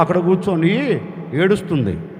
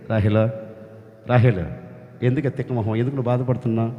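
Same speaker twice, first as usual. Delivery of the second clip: lower, softer, tender scolding; gentle as a man; 10 semitones lower, 6 dB quieter, faster than usual. Acted. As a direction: comfort her like a close friend. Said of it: "ఒక మనిషి ఎలా మాట్లాడుతున్నాడు". (దేవుడు రాహేలును మనిషిలా మృదువుగా ఓదార్చడం)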